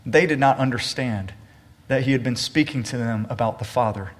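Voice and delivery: measured, solemn Bible reading; unhurried scripture reading to the church. Acted. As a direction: read the Gospel passage aloud to the congregation. Reads scripture aloud.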